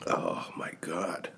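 whispered, slow gasp of pain; hushed, burning-mouth whisper; breathless whisper. Real, not acted. Reacting to burning hot sauce.

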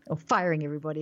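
mock American twang; light put-on American; American accent. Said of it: "Speaking like an American".

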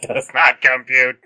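mechanical robot voice, lightly done; toy robot voice. Pretend. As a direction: intone like a glitching toy robot. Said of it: "I am a robot".

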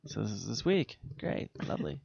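flat, quiet sarcasm; deadpan, bored sarcasm; sarcastic. Retold or imitated. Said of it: "and already I was like".

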